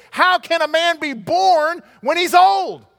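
loud, high, drawn-out disbelief; astonished protest at full voice; shocked Nicodemus. Acted. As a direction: cry out the question, stretched and incredulous. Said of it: "Nicodemus is shocked".

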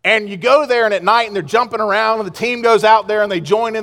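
ordinary talk

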